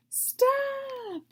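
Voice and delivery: a sudden high squealing cry of "Stop!"; piglet scream. Acted. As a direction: scream like a terrified little pig. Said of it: "screamed Wilbur".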